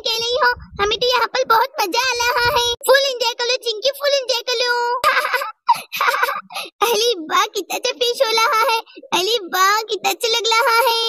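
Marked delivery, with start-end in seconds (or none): 4.8-6.8 playful giggle. Acted. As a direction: giggle like a kid splashing in the bath. Girls enjoying their bath in play.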